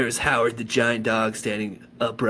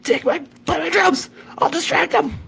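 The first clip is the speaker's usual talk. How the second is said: high, loud hurried orders; urgent shouting; 5 dB louder than usual. A narrator's reading. Ziggy shouting orders to Phoebe mid-song, high loud urgency. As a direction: bark quick orders mid-chaos.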